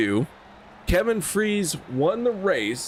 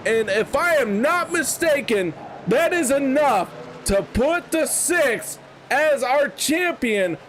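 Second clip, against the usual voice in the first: excited race call; 7 semitones higher than usual. Real, not acted. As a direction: call the win at the line. Race finish call over music.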